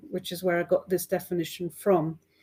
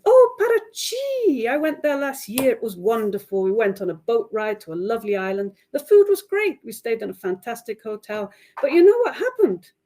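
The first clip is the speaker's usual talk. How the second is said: gushing teacher; 5 dB louder than usual. Acted. Teacher cutting in on the student, gushing excitedly. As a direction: rush in and take over the story.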